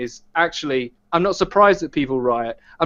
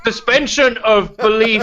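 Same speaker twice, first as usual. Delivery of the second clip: higher, slow, mocking voice; mocking misquote; 6 semitones higher, 4 dB louder than usual. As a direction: parrot the wrong term, slowly. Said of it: "misuse the term".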